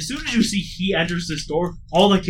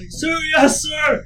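shouted sir yes sir; 13 semitones higher, 7 dB louder, much slower than usual. Acted. Kids answering drill instructor.

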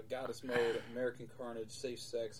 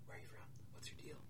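hushed, nosy classmates; very soft, conspiratorial questions; retold whispering; 14 dB quieter, faster than usual. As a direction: whisper it across the desks. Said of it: "whispering him".

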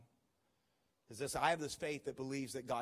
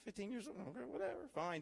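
high, grudging mock-teen voice; reluctant teen; 7 semitones higher, 5 dB quieter, faster than usual. Teen son grudgingly agreeing to pray for mom, dismissive. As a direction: shrug it off like a bored fifteen-year-old. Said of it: "I'm like".

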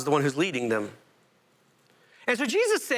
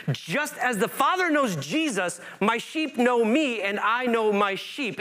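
raised, slow reading of the verse; slow preaching recital; 6 semitones higher, slower than usual. Acted. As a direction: linger on each word of scripture.

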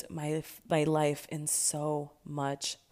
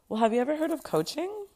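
lightly voiced upbeat coach, higher; a hint of a chirpy coach, pitched up; mild bright coach voice; 10 semitones higher, faster than usual. Coach asking her about coaching, pitched up lightly.

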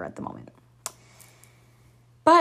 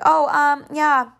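put-on clueless girl voice; 5 semitones higher than usual. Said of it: "she was like".

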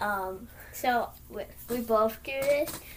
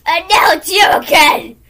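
loud exasperation; 6 semitones higher, 16 dB louder than usual. Acted. Mock dismissal.

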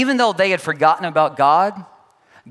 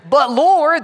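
higher, louder complaining protest; whiny Gideon; 8 semitones higher, 5 dB louder than usual. Retold or imitated. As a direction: object like a reluctant hero. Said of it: "Gideon asks".

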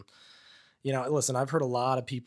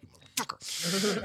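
slow, higher-pitched mock seething; resentful cursing; 7 semitones higher, 3 dB quieter, slower than usual. Grudging newcomer to pushy friend, resentful cursing. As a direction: curse him in drawn-out exasperation.

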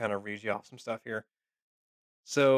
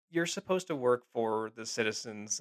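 normal talking voice

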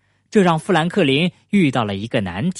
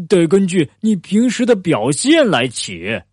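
lightly voiced character, higher pitch; bear chiming in; 4 semitones higher than usual. A narrator's reading. Bear adds a rule about nicknames, lightly voiced and higher. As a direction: chime in with a higher storybook voice.

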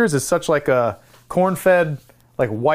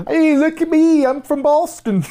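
much higher, hammed-up Boston accent; 11 semitones higher, 4 dB louder than usual. Shown as a demonstration. Cohost defending his evolving impression, hammed-up Boston accent. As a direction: ham up a bad Boston accent, pitched way up. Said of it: "It's evolving".

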